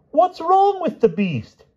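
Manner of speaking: very high, slow puzzled call; excited king. A narrator's reading. King asks after the beast, high and excited. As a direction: ask loudly and obliviously.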